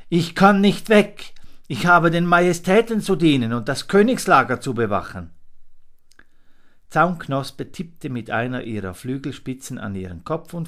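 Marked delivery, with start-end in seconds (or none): 0.1-5.3 high, loud eager little monkey; dutiful little monkey, fast and high; Fips's voice. A narrator's reading. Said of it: "aber er sagte".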